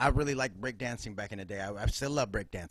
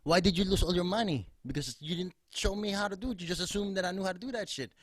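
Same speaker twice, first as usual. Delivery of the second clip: imitating dad; 6 semitones higher than usual. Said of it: "He was like".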